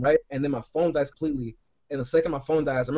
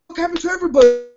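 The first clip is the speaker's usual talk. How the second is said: mock loud surprise; 12 semitones higher, 7 dB louder, faster than usual. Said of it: "He's like".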